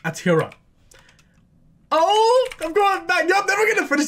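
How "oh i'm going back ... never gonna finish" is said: excited. Real, not acted. Overexcited rush.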